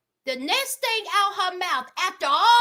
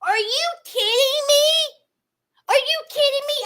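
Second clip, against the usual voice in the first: higher pitched, exasperated "are you kidding me"; outraged disbelief; 8 semitones higher, much faster than usual. Real, not acted. Host outraged at him forgetting her birthday, pitched high. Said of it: "outraged at the show".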